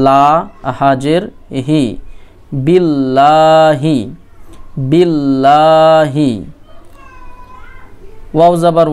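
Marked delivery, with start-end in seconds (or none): 2.7-6.3 বিল্লাহি drawn out with doubled ল্ল held; slow model recitation. Shown as a demonstration. Teacher sounds out the word for learners, slow and held. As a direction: say বিল্লাহি slowly, lingering on ল্ল. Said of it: "বা লামজের বিল".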